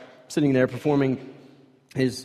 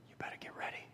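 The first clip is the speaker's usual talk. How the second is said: unvoiced, hushed warning; a whispered voice in the wilderness; 23 dB quieter, faster than usual. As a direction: breathe it as a secret warning. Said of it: "just a voice".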